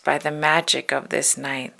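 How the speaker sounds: ordinary delivery, nothing marked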